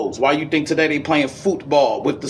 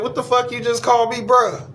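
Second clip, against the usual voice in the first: confronting challenge; 6 semitones higher than usual. Retold or imitated. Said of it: "I said".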